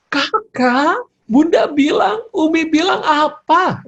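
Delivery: acted scolding, very high and loud. Mother confronts her older child, acted angry high voice. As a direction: scold like an exasperated mother, pitch way up. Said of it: "Intonasinya naik".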